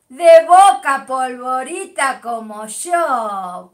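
loud, drawn-out fan cry; proud cheer. Real, not acted. Cheering her football club to a viewer, loud and slow.

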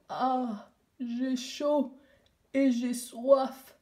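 put-on a hoarse, slow echo of the girl; voix enrouée. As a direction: rasp the words back slowly. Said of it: "une voix enrouée derrière moi". (voix rauque et lente qui répète)

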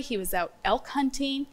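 normal talking voice

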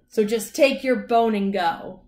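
indignant leprechaun order; angry shouting. A narrator's reading. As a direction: shout the intruder away. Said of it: "I shouted".

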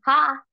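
short forced "ha" pushed out on the exhale; ha sound. Shown as a demonstration. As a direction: breathe out a sharp "ha". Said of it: "you're gonna make an H A".

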